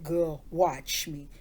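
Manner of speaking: slow, drawn-out challenge; deliberate, confident dare; cocky grandma. Retold or imitated. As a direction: imitate grandma boasting, taking her time. Grandma boasting she can eat corn.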